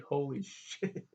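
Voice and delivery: slow, laughing holy shit; stunned laughing. Retold or imitated. Everyone's heads exploded, slow laughing disbelief. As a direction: let the laugh break the words. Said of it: "everyone was like".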